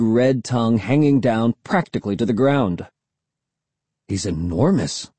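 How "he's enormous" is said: usual speaking voice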